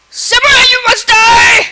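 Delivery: high, loud hammed-up threat; mock battle cry. Gamer challenging the samurai, mock shout.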